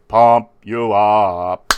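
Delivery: very loud, drawn-out strongman chant; Arnold-style pump-up. Acted. Gym-goer rallying on the count of three, loud strongman impression. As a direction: stretch it out like a bodybuilder. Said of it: "all the count of three".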